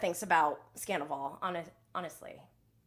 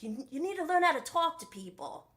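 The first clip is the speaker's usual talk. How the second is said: badly faked Australian accent, higher pitched; mock Australian scolding, pitched up; attempted Australian accent; 7 semitones higher than usual. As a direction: have a half-hearted go at an Australian accent. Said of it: "I can't really do the Australian accent".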